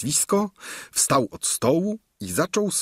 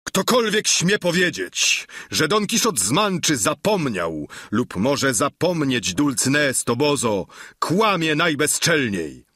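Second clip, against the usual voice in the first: shouting at full voice; 6 semitones higher than usual. Acted. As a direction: bellow your outrage through the wall. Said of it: "zawołał na całe gardło".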